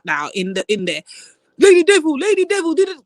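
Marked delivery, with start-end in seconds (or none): 1.6-3.0 high, loud mocking chant; mock taunt. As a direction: taunt like the haters chanting at her. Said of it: "mocking her critics".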